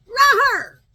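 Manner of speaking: loud, high mock roar; playful roar. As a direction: let out a big silly roar. Growls at her curls.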